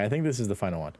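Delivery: ordinary talk, nothing marked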